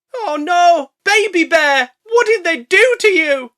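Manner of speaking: loud, high mock grief; hammed-up mama bear wail. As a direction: wail over your baby like a cartoon mother bear. Mama bear mourning cub.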